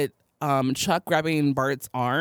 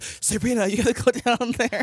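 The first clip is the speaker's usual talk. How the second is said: raised-pitch imitation of a frustrated wedding planner; mock angry planner; 7 semitones higher than usual. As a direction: play at angry nagging, pitched up. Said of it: "He's so angry".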